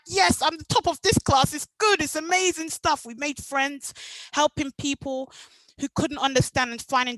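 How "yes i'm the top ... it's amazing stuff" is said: higher and louder, delighted; overjoyed schoolgirl bragging about top marks; gleeful boast. Retold or imitated. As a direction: crow with delight at being top of the class. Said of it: "I was like".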